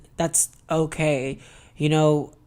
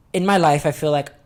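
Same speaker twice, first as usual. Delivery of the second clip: normal talking voice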